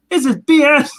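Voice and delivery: hammed-up fed-up protest; mock exasperation. Retelling Hank fed up with the nunchucks, mock exasperated cry. Acting Hank's fed-up outburst, then laughing.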